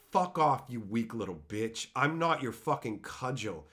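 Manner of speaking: ordinary talk, nothing marked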